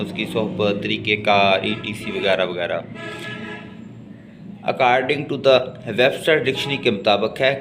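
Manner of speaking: ordinary talk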